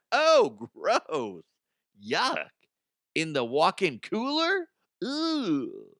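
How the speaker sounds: high, grossed-out reaction; disgusted. Retold or imitated. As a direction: recoil in mock disgust.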